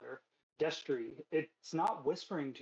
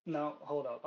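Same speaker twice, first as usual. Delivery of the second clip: ordinary talk, nothing marked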